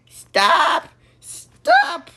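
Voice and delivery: high, loud shriek; frantic high-pitched yelling; panicked shout. Acted. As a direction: shriek it as a command. Yells at someone to stop.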